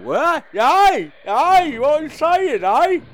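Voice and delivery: high, loud heckling voice; comic character voice. Pretend. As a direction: badger him in a daft high voice. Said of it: "stick with voice number seven".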